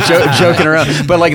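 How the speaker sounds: laughing as he explains it; words spilling out in a laugh; amused dad laughing. Real, not acted. Laughs after daughter's joke.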